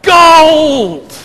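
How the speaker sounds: high, very loud triumphant yell. Acted. Man on the roller coaster yells his wish, loud high shout. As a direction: bellow it like he's striking it rich. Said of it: "he yells out".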